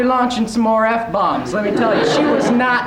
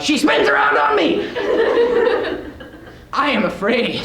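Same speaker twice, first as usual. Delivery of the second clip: laughing through the words, pitch higher; giggling as he admits it; 6 semitones higher than usual. Real, not acted. Telling the class how scared he was, laughing.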